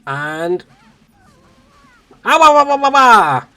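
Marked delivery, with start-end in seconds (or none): 2.2-3.4 high, loud cartoon wailing wahs; hammed-up baby wah sounds; funny wah noises. Doing silly noises for the stream, high loud wah wah wah. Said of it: "me just doing funny noises".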